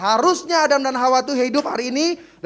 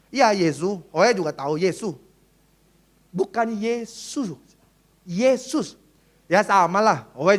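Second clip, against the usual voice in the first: put-on Chinese convert accent; 4 semitones lower, slower than usual. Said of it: "susah bilang Yesus".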